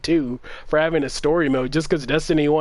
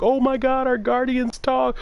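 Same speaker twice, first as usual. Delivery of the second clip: mock fan excitement; 10 semitones higher than usual. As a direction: parody hyped fans gushing. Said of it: "They're like".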